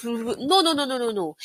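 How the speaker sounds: quick imitated babble of fast speech; mock fast talking. Shown as a demonstration. Coach mimicking speaking fast for learners, rapid mock babble. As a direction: rattle off rapid nonsense syllables. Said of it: "teaching you to speak fast".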